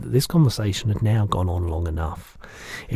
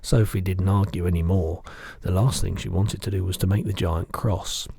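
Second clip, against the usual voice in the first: ordinary delivery, nothing marked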